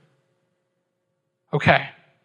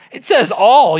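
higher and louder, leaning on says; emphatic all; 5 semitones higher, 6 dB louder than usual. Shown as a demonstration. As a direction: insist on the word all. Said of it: "we should be excited".

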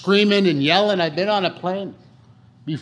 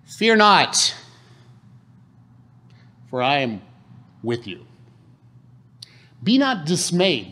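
scripture quote; slower than usual. Acted. Quoting scripture with weight.

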